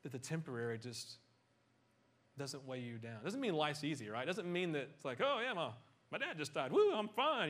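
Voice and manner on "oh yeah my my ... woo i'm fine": mock cheerful denial. Mock cheerful reaction to dad dying.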